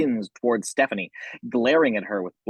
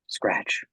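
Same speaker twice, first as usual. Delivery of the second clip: whispered scratch; 5 semitones lower, 3 dB quieter than usual. A narrator's reading. Narrator imitates scratching sound.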